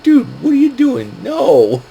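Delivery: high-pitched incredulous protest, retold; mock exasperation. Wrestler retold objecting to dodgeballs thrown at kids, mock exasperation. Acted exasperated quote amid laughter.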